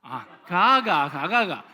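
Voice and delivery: loud, slow Gyeongsang 가가가 with a falling end; Gyeongsang accent. Shown as a demonstration. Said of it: "한번 들어보겠습니다".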